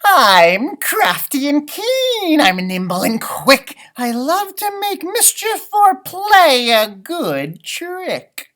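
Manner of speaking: high, slow, impish brag; boastful leprechaun. Acted. Leprechaun boasting to the class, high and impish. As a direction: show off like a cheeky imp.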